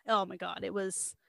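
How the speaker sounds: ordinary talk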